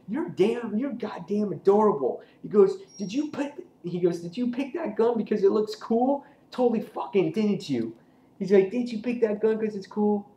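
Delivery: high teasing put-on voice; mocking Negan. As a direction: needle the kid in a high mocking tone.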